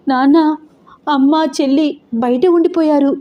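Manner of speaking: put-on scared child telling father; trembling voice. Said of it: "వణుకుతున్న గొంతుతో". (వణుకుతున్న భయపడిన గొంతు)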